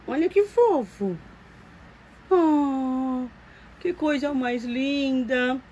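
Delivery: high, slow, doting coo. Real, not acted. Cooing over the dressed doll.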